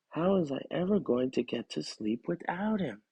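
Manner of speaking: ordinary delivery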